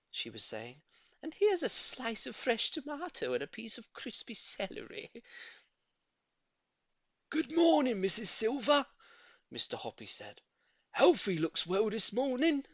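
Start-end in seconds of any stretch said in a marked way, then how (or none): 1.2-5.1 high, fond coaxing; doting lady voice. A narrator's reading. Old lady feeding her tortoise, high doting voice. 7.3-8.8 high, loud hello over the rail; cheery neighbour greeting. A narrator's reading. Shy neighbour greeting the lady below, bright loud call. 10.9-12.6 higher, louder polite chat; eager neighbour. A narrator's reading. Shy man complimenting her tortoise, eager and loud. Mr Hoppy voiced.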